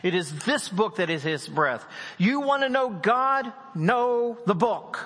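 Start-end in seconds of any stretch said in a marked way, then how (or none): 2.2-5.0 rising, forceful preaching push. Acted. Preacher urging the congregation to know the book, forceful. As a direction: drive it home to the congregation.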